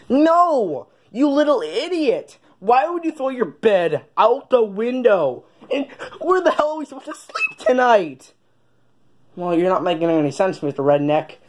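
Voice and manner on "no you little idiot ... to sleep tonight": cowboy scolding. Acted. Cowboy scolds kid over thrown bed.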